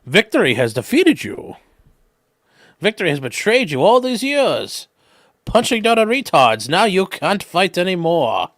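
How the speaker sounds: a hint of Bane, slow at the end; lightly menacing villain read; mild mock Bane speech. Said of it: "it's like Bane and Batman".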